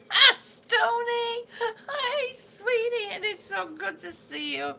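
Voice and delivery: high, loud delighted hello to a friend; excited greeting. Real, not acted. Greets a viewer who joins.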